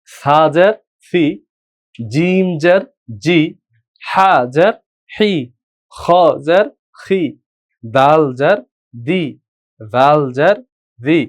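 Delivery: drawn-out chanted syllables, one by one; slow letter drill. Shown as a demonstration. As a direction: recite each syllable slowly for learners. Teacher drills letters with zer.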